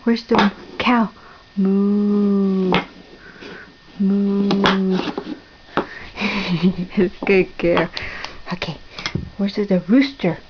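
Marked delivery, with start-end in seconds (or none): 1.6-5.0 two long, even moos; cow moo. Shown as a demonstration. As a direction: moo slowly for a toddler to copy. Models the cow's sound for toddler.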